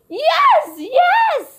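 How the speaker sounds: high, eager double yes; excited yes. Acted. Kids eagerly agree.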